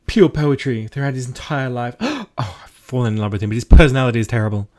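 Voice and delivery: high, loud, gushing and overacted; flamboyant art dealer. GM voicing a flamboyant art dealer NPC, high loud gushing. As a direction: swoon like a theatrical art snob. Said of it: "art dealer who's like".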